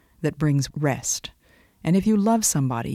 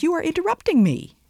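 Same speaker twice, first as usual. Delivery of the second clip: much higher, pleading little critter voice; squeaky chipmunk cry; 13 semitones higher, faster than usual. Acted. Preacher voicing a chipmunk begging the Great Spirit, high squeaky plea. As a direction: squeal it like a tiny frantic chipmunk. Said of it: "cried the little chipmunk".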